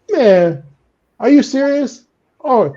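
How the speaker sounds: high-pitched incredulous outburst; indignant, pitch jumping up; going ballistic. Acted. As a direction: cry out in outraged disbelief. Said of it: "I'm going ballistic because I'm thinking".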